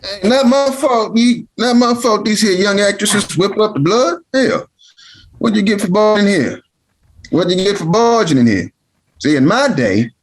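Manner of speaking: put-on high-pitched grumpy old man protesting; cranky old uncle. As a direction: bristle back like an old man caught out. Old uncle's defensive retort.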